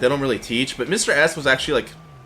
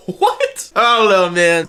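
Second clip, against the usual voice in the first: laughing disbelief; 5 semitones higher, 5 dB louder, slower than usual. Real, not acted. Laughing incredulous reaction.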